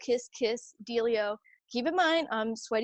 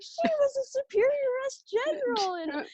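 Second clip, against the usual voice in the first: lightly voiced awestruck nuns, much higher; awed sisters. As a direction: announce her rank with reverence. Sisters impressed, voiced high.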